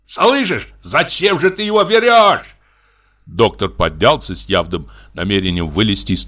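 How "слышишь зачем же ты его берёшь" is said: high-pitched, insistent challenge; heated demand. Acted. Brother arguing over the doctor's seat, heated demand. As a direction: press the point in a raised pitch. Acted quarrel, brother pressing his point.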